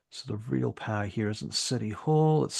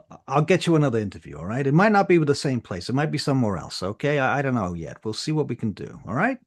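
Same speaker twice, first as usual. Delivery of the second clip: hurried reassurance; 3 semitones higher, 6 dB louder, faster than usual. Acted. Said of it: "he goes".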